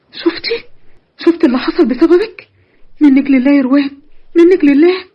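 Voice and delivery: tearful accusing cry; crying blame. Acted. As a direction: sob out the blame. هناء تلوم أختها باكية. (لوم باكٍ ومنفعل)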